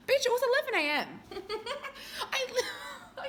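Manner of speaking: laughing. Real, not acted. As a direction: laugh so hard the words crack. Cracks up retelling boyfriend line.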